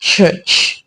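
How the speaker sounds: "church" pushed out as a breathy, voiceless hiss; whisper-hiss the word "church"; stress on church. Retold or imitated. Retelling the child's pointed jab, hissing "church". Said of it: "the child emphasized on the word".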